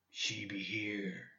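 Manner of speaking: slow, very soft growled whisper; hushed, grim hunter's murmur; scowling. A narrator's reading. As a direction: whisper it slowly through a scowl. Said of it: "said with lips drawn in a perpetual scowl".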